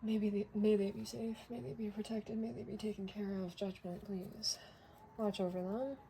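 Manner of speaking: soft, near whispered blessing; quiet prayer. Real, not acted. Reader hearing sirens and praying for those in danger, softly. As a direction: murmur it like a small private prayer.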